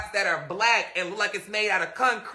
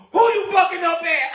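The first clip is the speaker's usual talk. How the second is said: higher challenging father voice; a hint of the dad demanding; mock stern father; 9 semitones higher, 3 dB louder than usual. Father confronting daughter.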